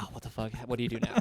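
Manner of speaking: hushed, retold bewilderment; muttered dismay. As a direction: recount a quiet "uh-oh" under your breath. Muttered reaction at stopped entry.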